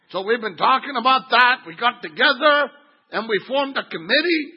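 loud, high, self-important report; hammed-up delegate announcing a grievance phrase by phrase; mock indignant complaint. Churchgoers report their committee to the pastor, mock indignant.

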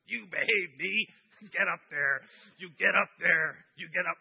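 loud stern commands to her own knee; Lorraine scolding her knee. Retold or imitated. Pastor acting Lorraine ordering her injured knee, loud and stern. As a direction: bark at the knee to get up the ladder. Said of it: "and she's like".

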